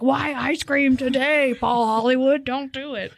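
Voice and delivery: high, slow, exasperated yell at Paul Hollywood; pleading with the TV judge not to do it; half-hearted mock shout at the screen. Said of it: "yelling at the T V".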